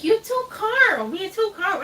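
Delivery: high, drawn-out imitation of a fussing friend; exclaimed retold remark. Designer exclaiming to the waiting bride, high-pitched imitation. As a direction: squeal it as the designer's mock complaint.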